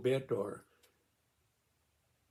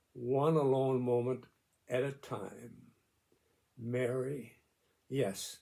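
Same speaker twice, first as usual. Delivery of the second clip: reading a personal note. Acted. Reads his written note aloud.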